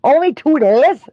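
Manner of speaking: high, loud incredulous question; can't believe how lucky he is; surprised disbelief. Real, not acted. To the friend who's only sick two days, loud disbelief.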